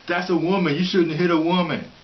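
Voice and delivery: high, loud mocking protester voice; loud sarcastic imitation of a protester. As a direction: parody their outrage loudly. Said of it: "He says".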